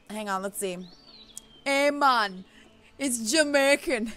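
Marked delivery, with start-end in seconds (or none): 1.6-4.1 slow attempt at a Jamaican accent; amateur Jamaican accent. Shown as a demonstration. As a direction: try on a Jamaican accent, drawn out. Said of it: "I wanna go for the Jamaican accent".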